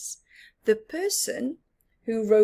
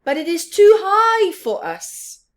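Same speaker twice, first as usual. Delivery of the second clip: much louder, higher worried fox; 4 semitones higher, 10 dB louder than usual. Acted. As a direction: cry it out up high. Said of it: "said fox number one".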